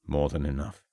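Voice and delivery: hushed, low-voiced contact; low mysterious man. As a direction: speak it low and secretive.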